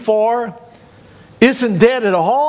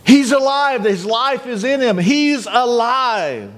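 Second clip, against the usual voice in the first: raised, jubilant cry; 4 semitones higher than usual. Retold or imitated. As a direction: proclaim it to everyone, stretching the last words. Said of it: "Paul was able to cry out".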